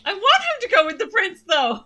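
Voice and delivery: loud protest. Real, not acted. Player protests loudly.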